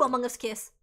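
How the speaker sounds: ordinary talk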